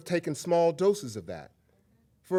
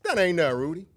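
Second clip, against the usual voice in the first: one-upping brag; 4 semitones higher, 7 dB louder, faster than usual. Retold or imitated. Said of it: "I'm like".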